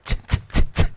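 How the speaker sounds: voiceless tongue clicks in a row; disapproving tsk tsk. Retold or imitated. Bailiff scolding the laughing juror, voiceless clicks. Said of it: "And the bailiff, like".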